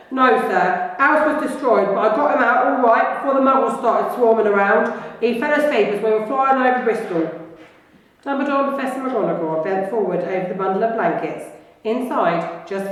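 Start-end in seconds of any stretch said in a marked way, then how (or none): 0.1-7.3 a louder, rougher giant's voice, apologetic; Hagrid, big and gentle. Acted. Hagrid explaining himself to Dumbledore in a big voice. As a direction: play the kind giant owning up to his teacher.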